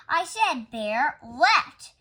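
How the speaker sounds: loud, very slow, stressed words; exasperated explaining. A narrator's reading. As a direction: spell it out in frustration. Frustrated man explains.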